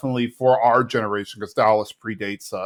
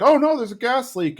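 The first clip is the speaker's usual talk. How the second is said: mock alarm; 13 semitones higher, 3 dB louder than usual. Acting out Hank smelling gas.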